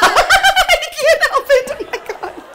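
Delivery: very high squeal through laughter; loud laughing shriek, tailing off; real helpless laughter. Real, not acted. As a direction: talk while cracking up completely. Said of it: "it just cracks me up".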